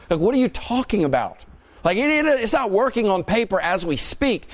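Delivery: high, pleading wife's objections; upset protest. Acted. Wife protesting husband's three days off work, upset and pleading.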